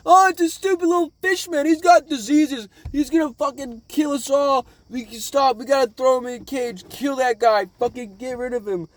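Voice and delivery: high, loud mock outcry; jeering crowd. Crowd reacting to the fish man, high mock alarm. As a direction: imitate a panicked, hateful mob in a high voice. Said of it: "everyone around the crowd is like".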